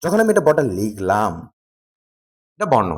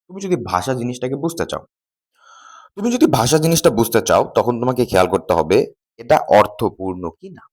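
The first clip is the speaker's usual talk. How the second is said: usual speaking voice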